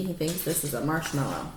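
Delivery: plain reading voice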